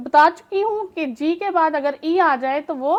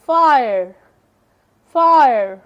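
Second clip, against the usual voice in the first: Fire said loud, a bit low; word demo; 3 semitones lower, 5 dB louder, much slower than usual. Shown as a demonstration. Teacher to students, modelling fire loudly. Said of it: "अपनी long sound ही देगा".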